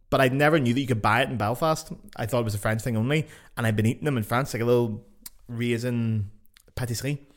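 ordinary talk, nothing marked

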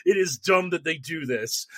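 ordinary delivery

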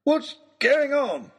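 high, slow, drawn-out outburst; lordly indignant cry; indignant demand. A narrator's reading. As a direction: demand it as an outraged lord. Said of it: "demanded Lord Daunt".

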